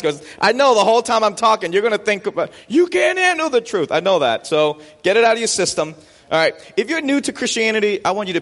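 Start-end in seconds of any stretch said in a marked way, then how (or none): 2.7-3.9 high-pitched movie-quote shout; Jack Nicklaus shouting back. Acted. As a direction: fire back like a dramatic courtroom outburst. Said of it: "Jack Nicklaus shouted back".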